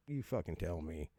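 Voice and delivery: normal talking voice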